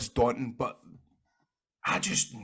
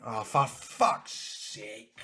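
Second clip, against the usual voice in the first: weary groan; 2 semitones lower, 3 dB quieter, much slower than usual. Retold or imitated. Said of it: "he looked at me and he went".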